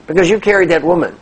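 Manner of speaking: loud, high-pitched scolding retort; acted indignant accusation; angry monk. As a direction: snap back as the outraged monk. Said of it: "his colleague is angry".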